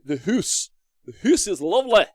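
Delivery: hammed-up Scottish accent with rolling delight. Streamer admiring a tavern model as a Scottish dwarf, mock Scottish accent. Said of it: "full of Scottish dwarves".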